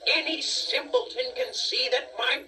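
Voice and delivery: high, scornful dwarf voice; irate dwarf. Acted. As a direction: sneer at the simpletons. Dwarf voice continues.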